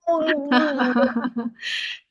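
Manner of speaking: real burst of laughter. Real, not acted. Teacher reacting to student's halting reading, laughing.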